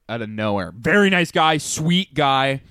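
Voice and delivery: usual speaking voice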